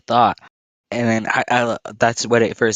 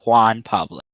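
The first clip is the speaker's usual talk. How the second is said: Spanish pronunciation; slower than usual. Retold or imitated. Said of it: "That's what I said to my grandma".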